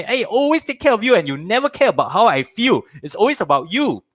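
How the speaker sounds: put-on high, hurt complaint to the child; mock upset parent scolding; half-acted wounded retort; parent snapping back. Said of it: "we always go and say".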